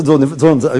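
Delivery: usual speaking voice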